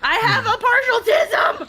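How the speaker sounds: high, loud, slow laughing yell; laughing shout. Real, not acted. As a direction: shriek it through laughter.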